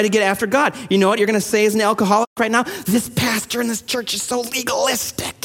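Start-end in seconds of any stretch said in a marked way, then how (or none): 2.9-5.4 mock whiny complaint. Mocking imagined alcoholic's complaint.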